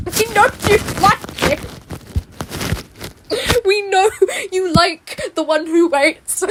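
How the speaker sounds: sing-song teasing. Real, not acted. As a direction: call out the fans playfully. Teasing fans.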